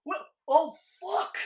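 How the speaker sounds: loud, high cry of dismay; mock shocked shout. Host acts out viewers seeing glass shot, mock shocked shout. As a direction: belt out a viewer's horrified reaction.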